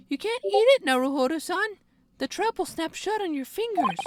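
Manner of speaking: put-on bright high polite young woman. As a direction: lift the voice way up, sweet and proper. Voices Susato in game.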